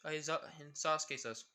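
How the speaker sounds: ordinary talk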